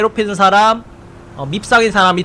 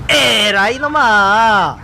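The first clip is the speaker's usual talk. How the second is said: slow drawn-out battle cry; much slower than usual. Acted. Throwing a punch.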